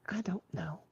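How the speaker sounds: whispered, almost gone. Real, not acted. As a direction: drop to a breathy whisper. Trails off answering co-host.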